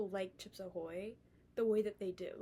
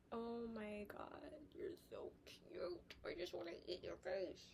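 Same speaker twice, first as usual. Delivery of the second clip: soft, whispered cooing over a puppy; 9 dB quieter than usual. Real, not acted. Cooing over her dog.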